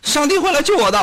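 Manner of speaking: high stubborn cry of faith; mock proclamation from the water; loud cry. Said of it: "高声喝喊".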